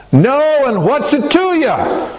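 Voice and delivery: high-pitched, testy comeback; mock-indignant high yelled reply; irritated retort. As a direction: throw it back high and defiant. Tired fishermen snapping back at stranger.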